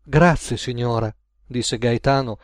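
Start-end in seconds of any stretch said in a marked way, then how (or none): none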